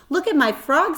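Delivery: put-on eager, high and loud little boy; excited boy. A narrator's reading. Boy showing off his frog, excited and loud. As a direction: show off the frog, high and bright. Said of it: "said Avery".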